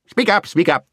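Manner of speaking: high, insistent demand; impatient deaf dwarf. A narrator's reading. Deaf dwarf straining to hear the owl, impatient.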